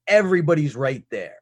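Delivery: ordinary delivery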